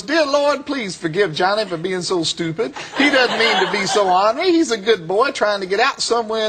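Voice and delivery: high, pleading mock prayer; high-pitched comic supplication; imitated praying reverend. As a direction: mimic a preacher earnestly praying down the phone. Reverend prays aloud for the caller.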